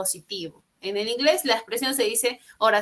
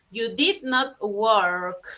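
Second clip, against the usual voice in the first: very slow, words set apart; slow model sentence; much slower than usual. Shown as a demonstration. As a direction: dictate it clearly.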